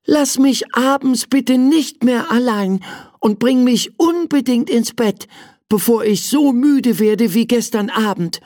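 put-on a mild pleading sigh, high child voice. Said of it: "seufzte der kleine Prinz".

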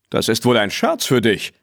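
usual speaking voice

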